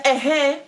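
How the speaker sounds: "Eh, eh" on a high tone, two quick syllables meaning yes; tonal eh eh yes. Shown as a demonstration. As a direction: say "Eh, eh" high to agree.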